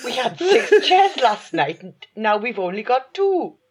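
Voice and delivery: put-on Welsh pub men's accent; slow, high Welsh publican voice. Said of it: "But that's how they sounded".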